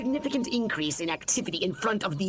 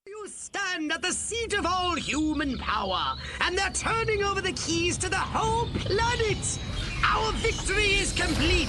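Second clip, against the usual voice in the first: triumphant proclamation; 7 semitones higher than usual. Acted. Declares victory over humans.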